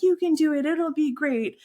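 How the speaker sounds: high, loud encouraging cheer; rally her like a supportive crowd. Retold or imitated. TED audience cheering the stuck speaker, high loud encouragement. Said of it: "they're like".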